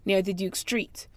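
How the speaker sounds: careful, even read-aloud voice; reading a passage aloud. Acted. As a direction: read it like a set text for a recording. Reads the set passage aloud.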